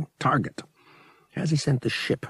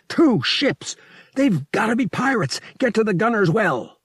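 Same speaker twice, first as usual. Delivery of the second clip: urgent alarm; 11 semitones higher than usual. Acted. Han alarmed at pirate attack.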